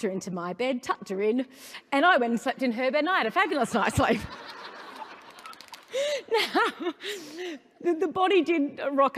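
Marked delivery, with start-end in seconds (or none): 3.0-4.2 laughing through the punchline; breaking into laughter at her own joke. Real, not acted. Speaker laughs at her own story, laughing. 4.3-6.2 a high, unvoiced burst of laughter; breathy high laugh. Real, not acted. Speaker cracks up at her own story, laughing. 6.3-9.2 laughing. Real, not acted. Speaker tells the audience the ending, laughing.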